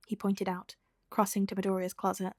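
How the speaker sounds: ordinary delivery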